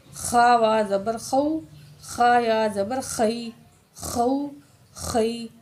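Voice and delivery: "خا" with a long scraped fricative; throaty kha. Shown as a demonstration. Teacher drills after correcting, "خا" with throaty kh. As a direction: rasp the start of "خو، خئی". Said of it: "خا پڑھنا ہے".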